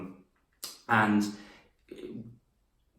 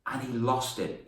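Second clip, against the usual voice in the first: usual speaking voice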